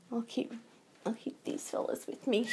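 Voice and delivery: hushed, private little aside; whispered aside. Real, not acted. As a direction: whisper it softly to yourself.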